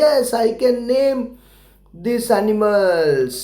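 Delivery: put-on a clear model sentence for kids to copy; slow model answer. Shown as a demonstration. Teacher showing pupils the answer to say, slowly. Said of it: "तो आप बोलना चाहिए".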